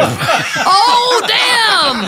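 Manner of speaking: high, drawn-out exclamation; shocked outburst. Acted. Reacting to the missing boy's reveal, shocked outburst. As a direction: cry out in amused shock.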